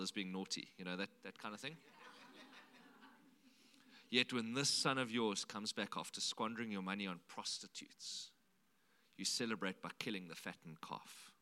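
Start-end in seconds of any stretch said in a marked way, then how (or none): 4.1-11.3 1 bitter. Retold or imitated. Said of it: "now listen to the bitterness".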